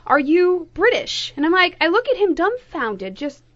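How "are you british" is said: retold question. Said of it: "And the guy asks me".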